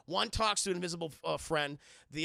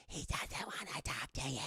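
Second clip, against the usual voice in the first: creepy ghost voice; 6 semitones lower, 8 dB quieter than usual. Retold or imitated.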